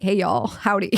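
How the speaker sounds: lightly put-on Southern American accent greeting; mock Southern howdy. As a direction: greet them in a hint of Southern American to blend in. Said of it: "trying to blend in".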